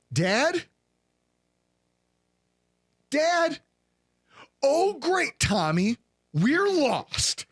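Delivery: put-on high child voice calling for dad; imitating a worried little kid; lost child calling.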